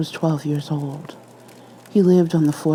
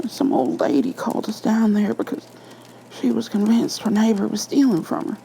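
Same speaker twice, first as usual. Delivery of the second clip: higher-pitched, uneasy storyteller voice; a spooked cop reliving it; officer telling his ghost story, rattled and higher; nervous officer Liu; 6 semitones higher than usual. A narrator's reading.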